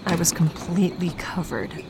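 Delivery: hushed, breathless whisper; voiceless whisper, all breath. Acted. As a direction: let it drop to a whisper of shame. Narrator whispers humiliation.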